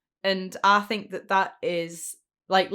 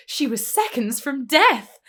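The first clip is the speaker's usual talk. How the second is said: raised, slow, dramatic quote; Jackie on the phone; 6 semitones higher, 7 dB louder, slower than usual. Retold or imitated. Retelling Jackie's phone call, overdramatic. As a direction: stretch it out high and loud. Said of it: "and she goes".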